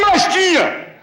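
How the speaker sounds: lifted pulpit delivery; preaching. Acted. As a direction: preach it up to the congregation. Rising sermon on oil, flour.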